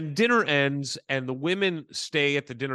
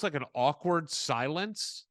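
ordinary talk

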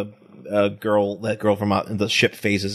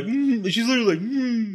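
upset hum; 10 semitones higher than usual. Retold or imitated. Nikki upset as he leaves.

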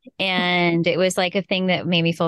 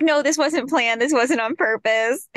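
defensive protest; 6 semitones higher, slower than usual. Retold or imitated. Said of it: "and I'm like".

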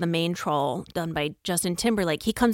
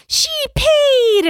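put-on much higher, loud child's announcement; tattling kid; 9 dB louder than usual. Mom voicing the friend, a child.

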